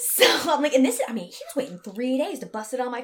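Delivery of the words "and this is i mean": ordinary delivery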